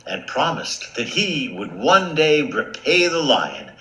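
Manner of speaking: ordinary delivery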